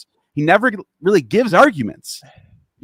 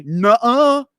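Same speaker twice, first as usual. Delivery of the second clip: childish mock denial; 7 semitones higher than usual. Mocking Dan's non-argument.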